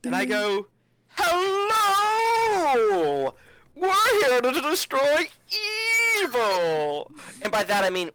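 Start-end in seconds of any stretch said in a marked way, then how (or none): 1.1-7.1 loud, high, drawn-out entrance cry; big hammed-up battle announcement; grand announcing shout. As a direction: bellow a heroic arrival into the shop. Player bursts into the shop shouting.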